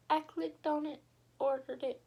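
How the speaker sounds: rushing out the excitement; excited. Real, not acted. Excited about ordering tripod.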